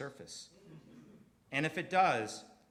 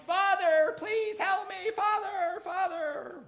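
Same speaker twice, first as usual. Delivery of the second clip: much higher and louder, crying out to his father; Luke yelling; 9 dB louder, slower than usual. Retold or imitated. Luke begging his father while Palpatine kills him, high desperate yell. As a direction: cry out for help, high and desperate. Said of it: "Luke is yelling".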